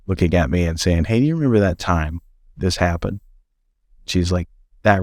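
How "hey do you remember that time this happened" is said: ordinary talk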